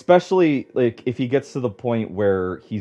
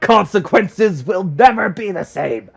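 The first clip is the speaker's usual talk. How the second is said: meme quote; 9 semitones higher, 6 dB louder than usual. Retold or imitated. Quoting a meme in mock voice.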